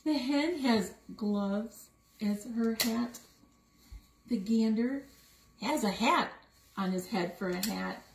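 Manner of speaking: plain reading voice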